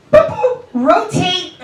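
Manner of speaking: high, loud whistle-like boop; mock referee call. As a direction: call the rotation like a referee, drawn out. Imitating a volleyball referee's call.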